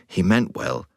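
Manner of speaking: ordinary delivery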